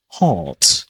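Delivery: "heart" as "haht", long vowel and no r; dropped R. Shown as a demonstration. Teacher repeats the word for learners, R dropped. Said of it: "Dropping the letter R at the end".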